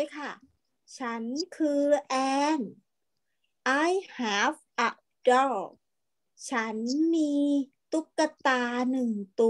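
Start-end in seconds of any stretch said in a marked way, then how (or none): 3.9-5.6 very slow, word by word; slow model reading. Shown as a demonstration. Teacher reading to online class, slow and clear. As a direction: read "I have a kite" slowly for children to repeat. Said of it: "อ่านตามนะ".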